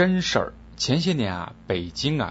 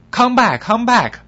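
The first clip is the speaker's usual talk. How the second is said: much higher, louder call after someone; 12 semitones higher, 9 dB louder, slower than usual. Acted. Tout calling the foreigner back loudly. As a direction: hail him back. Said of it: "就用英文把老外叫回来了".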